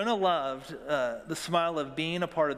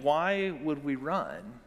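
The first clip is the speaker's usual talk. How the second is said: ordinary talk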